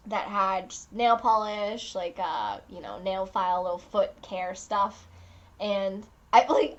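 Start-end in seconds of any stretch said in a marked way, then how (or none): none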